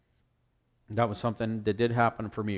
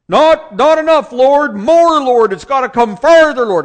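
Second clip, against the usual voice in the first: much higher, loud, slow insistence; loud demanding prayer; 17 dB louder, slower than usual. Retold or imitated. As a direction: push God for more like a shouting healer. Healer pleading loudly with God.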